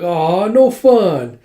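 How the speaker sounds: retold sulky protest; pouty complaint. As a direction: stretch it out in mock disappointment. Retold complaint at being excluded.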